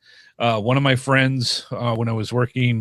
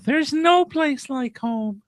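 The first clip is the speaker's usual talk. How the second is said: high, slow, drawn-out movie line; mock wistful quote; 13 semitones higher, slower than usual. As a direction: stretch it out high and dreamy. Quoting Dorothy at mention of Kansas.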